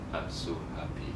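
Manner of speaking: low, soft, glum cartoon-dog mumble; Droopy. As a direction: sound utterly deflated while claiming joy. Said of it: "the dog Droopy in Tex Avery".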